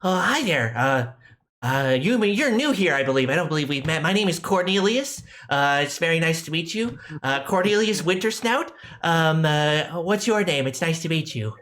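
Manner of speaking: put-on high nasal kid voice; nasally kid voice. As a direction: play a cheery nasally kid blacksmith. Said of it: "My nasally kid voice is my best voice".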